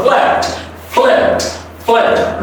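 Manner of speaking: 'flat' for flapped, said as flapt; crisp unvoiced T closing 'flat'; soft T ending. Shown as a demonstration. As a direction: end each 'flat' in p plus a soft T. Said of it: "yes, a soft T".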